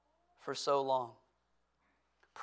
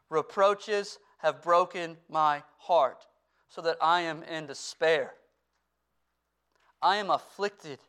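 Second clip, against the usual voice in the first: raised, louder reading of a psalm; scripture reading; 3 semitones higher, 5 dB louder than usual. Acted. Pastor reading Psalm sixty-nine to his congregation, fervent reading. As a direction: read the psalm as a heartfelt cry.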